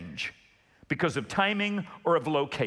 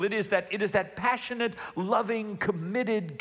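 ordinary delivery

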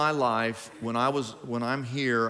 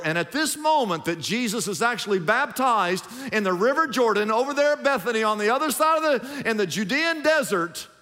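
preaching at full force; 7 semitones higher than usual. Acted. Pastor stirring the congregation about Jesus, preaching at full force. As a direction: lift the congregation higher line by line.

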